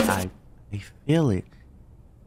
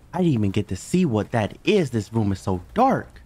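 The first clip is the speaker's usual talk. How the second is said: usual speaking voice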